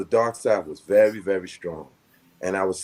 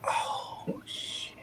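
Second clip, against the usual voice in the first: stunned whisper; unvoiced dismayed whisper; whispered curse; 11 dB quieter than usual. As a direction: breathe it out, shocked and hushed. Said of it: "I said".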